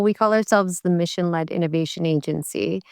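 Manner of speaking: normal talking voice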